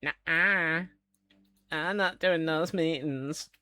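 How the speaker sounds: stubbornly refuse, slowly; playful refusal. Real, not acted. Refusing meetings.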